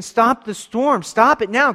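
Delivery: high, loud scream, first words dragged out slow; screaming plea. Pretend. Believers screaming at God to stop the storm, loud. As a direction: yell it at the sky in panic. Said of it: "While we're screaming".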